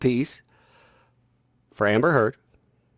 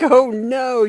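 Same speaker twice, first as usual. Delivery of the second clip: mock dismay; 13 semitones higher, 7 dB louder than usual. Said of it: "I was like".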